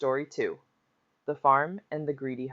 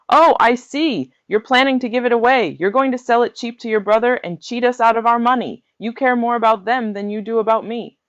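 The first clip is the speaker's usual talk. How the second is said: furious, much higher and louder yelling; angry shouting; 10 semitones higher, 7 dB louder than usual. A narrator's reading. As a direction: roar the accusations at his wife.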